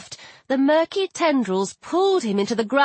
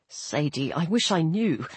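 low, soft and sorry; low sad voice; 7 semitones lower, 5 dB quieter than usual. A narrator's reading. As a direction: break it to her gently. Anubis voiced, sad news.

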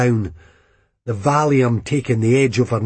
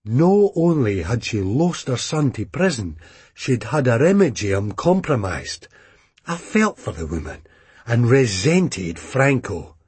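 ordinary delivery

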